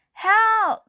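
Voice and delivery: high, loud cry of help; panicked shout for help; desperate call for help. A narrator's reading. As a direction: yell it out to the neighbours. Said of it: "Missus Watson called again".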